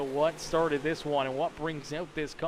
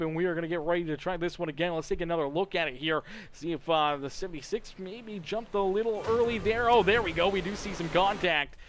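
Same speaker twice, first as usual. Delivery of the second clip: ordinary talk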